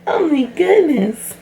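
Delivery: high, slow cooing. Real, not acted. Cooing over her baby.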